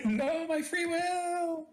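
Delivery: very high, drawn-out wail; hammed-up high, slow plea; mock protest. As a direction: wail it like a tiny creature being swallowed. Tiny follower protests being absorbed.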